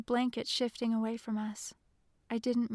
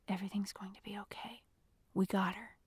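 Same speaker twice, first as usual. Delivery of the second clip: hushed reassurance; 2 semitones lower, 5 dB quieter than usual. A narrator's reading. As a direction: reassure under your breath. Jeremy reassuring Christy while hiding.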